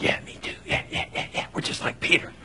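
whispered, half-laughing me too; mock whispered agreement. The other disciples echoing Peter, mock whisper with laughs. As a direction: murmur along like sheepish followers. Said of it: "the rest of them are like".

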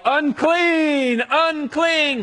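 high, drawn-out call of warning; long high holler, ringing out; leper's warning yell. Acted. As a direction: yell it out like a town crier. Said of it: "They would also have to yell".

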